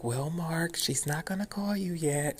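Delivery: high, mimicked receptionist putting someone off; overfamiliar receptionist. Receptionist telling caller to keep waiting, overfamiliar. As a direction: let him down high and chummy, like you know him. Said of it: "talking to me as if she had known me for years".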